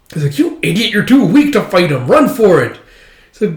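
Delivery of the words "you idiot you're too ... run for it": high, loud warning shout; urgent scolding. Retold or imitated. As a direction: yell at a fool to flee. Urgent warning in acted fight.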